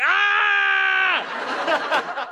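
shrill high falling scream; terrified scream. Retold or imitated. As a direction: scream like you are falling. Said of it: "the last thing I'll think is just like".

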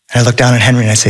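ordinary delivery, nothing marked

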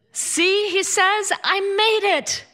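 high, drawn-out crowing; sing-song mock boast of a late arrival; triumphant husband. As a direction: crow it high and slow, pleased with yourself.

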